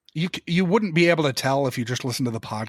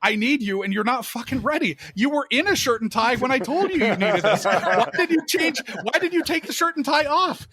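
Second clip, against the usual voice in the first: high-pitched, exasperated yelling over the radio; retold angry yell; 9 semitones higher than usual. AD yelling over walkie at missing extra, high exasperated rant. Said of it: "getting yelled at over a walkie".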